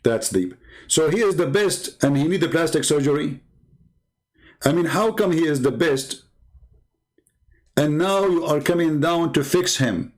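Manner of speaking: ordinary delivery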